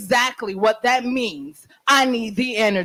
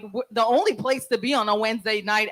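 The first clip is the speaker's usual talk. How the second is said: ordinary delivery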